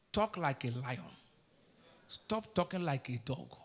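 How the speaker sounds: ordinary talk, nothing marked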